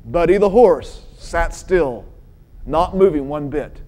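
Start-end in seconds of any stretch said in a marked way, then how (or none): none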